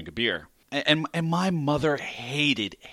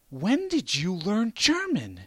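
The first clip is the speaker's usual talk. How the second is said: slow, higher incredulous question; incredulous mother; 9 semitones higher, slower than usual. Retold or imitated. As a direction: ask in slow disbelief. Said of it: "She's incredulous".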